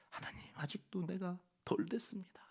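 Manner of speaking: hushed confession to God; whispered humble confession; whispered prayer. Acted.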